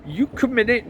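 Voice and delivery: high, loud Welsh priest; shrill-pitched, loud Welsh complaint; Welsh accent. A narrator's reading. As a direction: object indignantly in a Welsh lilt. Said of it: "trying to do a Welsh accent".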